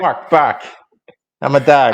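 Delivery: put-on a light Boston accent barking. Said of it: "he would bark in a Boston accent".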